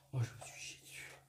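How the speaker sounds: frightened, breathy whisper; scared whisper. Real, not acted. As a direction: whisper it, spooked in the dark. Spooked during ghost session.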